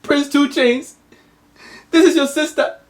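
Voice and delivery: high, hammed-up tearful mother; weepy mother. Mother introducing the prince's lost sister, hammed-up tearful. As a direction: announce it through fake tears. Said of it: "the mom goes".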